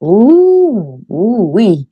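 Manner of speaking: surprised. Real, not acted. Surprised at the question.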